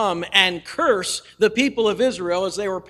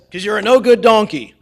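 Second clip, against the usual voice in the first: annoyed retort; 2 semitones higher, 9 dB louder than usual. Retold or imitated. Balaam answers the talking donkey.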